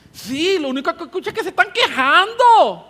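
high, loud scold, Dejen de quejarse ya slowed; fed-up divine voice; God scolding. Acted. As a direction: scold the grumblers sharply. God's voice, scolding.

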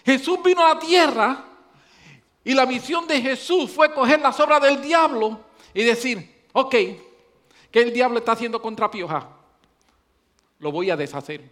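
loud, high preacher's build-up; preaching at full force. Acted. Preacher warning the congregation, loud and high. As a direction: drive the warning home to the church.

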